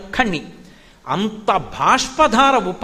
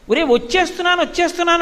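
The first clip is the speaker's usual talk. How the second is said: put-on higher, coaxing call across to a child; mother calling out; 7 semitones higher, faster than usual. Mother calling across to her crying son, high coaxing call. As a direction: call out to soothe the boy from afar.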